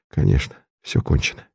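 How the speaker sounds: fast, hushed whisper; a doomed man whispering to himself; whispered despair. A narrator's reading. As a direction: breathe it out, all hope gone. Condemned man's hopeless inner thought.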